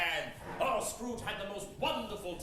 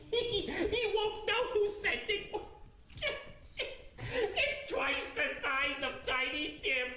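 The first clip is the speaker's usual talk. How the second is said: slow words broken by laughter; gleeful giggling; 13 semitones higher, slower than usual. Acted. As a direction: cackle with delight at the secret gift. Scrooge giggles at his surprise.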